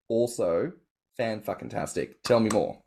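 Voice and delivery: ordinary delivery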